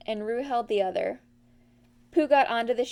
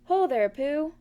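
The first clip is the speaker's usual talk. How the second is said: a raised hail from below; calling out; 5 semitones higher, 4 dB louder, slower than usual. A narrator's reading. Gopher calling up to Pooh, raised hail. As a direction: call up to him. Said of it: "heard someone call".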